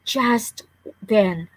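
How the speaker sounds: usual speaking voice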